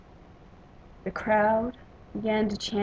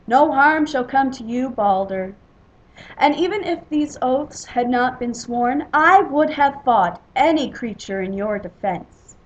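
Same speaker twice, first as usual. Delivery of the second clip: loud sworn vow; 3 semitones higher, 9 dB louder than usual. A narrator's reading. Warrior swears to defend Balder, loud proclamation. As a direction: proclaim it loudly to the hall.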